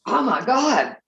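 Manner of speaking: on the verge of tears from a choking fit; pained cry. Real, not acted. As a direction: let the hurt crack through, slowly. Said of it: "Man, that hurt".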